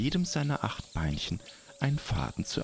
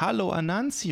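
high monkey voices; 10 semitones higher, 3 dB louder than usual. Acted. Monkeys call out greeting.

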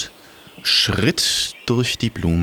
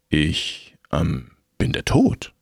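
grim reaper voice; 5 semitones lower than usual. Acted. Death voice, low.

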